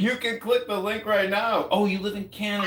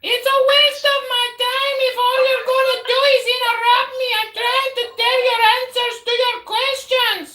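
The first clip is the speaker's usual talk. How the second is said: shouting match; 12 semitones higher than usual. Real, not acted. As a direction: shout over the heckler. Yelling back in argument.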